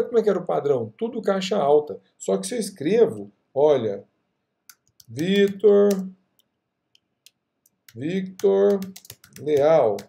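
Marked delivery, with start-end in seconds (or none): 5.1-10.0 drawn-out, spaced-out words; typing aloud. Real, not acted. Teacher typing a name for the class, drawn out. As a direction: sound out the name as you type.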